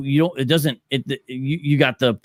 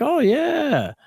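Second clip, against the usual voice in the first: mock reply; 13 semitones higher than usual. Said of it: "and you're like".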